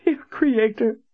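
sobbing. Acted. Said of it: "forlorn sobs".

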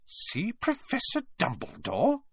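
slow, high, rising disbelief; suspicious echo. A narrator's reading. McGonagall echoes the pupils' request, deeply suspicious. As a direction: repeat it as though it's very fishy. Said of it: "as though this was a very fishy thing".

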